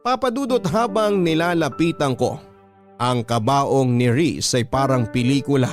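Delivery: normal talking voice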